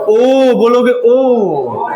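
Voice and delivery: ओ drawn out slowly, lips rounded; rounded o demo. Shown as a demonstration. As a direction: stretch ओ to show round lips. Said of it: "तो ओ हो गया ना वर्तुल". (होठ गोल करके धीमा, लंबा ओ)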